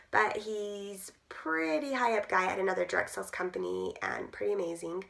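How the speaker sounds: normal talking voice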